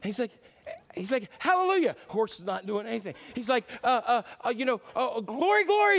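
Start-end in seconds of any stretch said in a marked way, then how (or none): 1.4-1.9 high, loud panicked cry. Acted. Rider trying to stop the horse, panicked high cry. 3.8-6.0 stammering, then a high loud cry; flustered panic. Acted. Rider forgetting the stop word, panicked stammer.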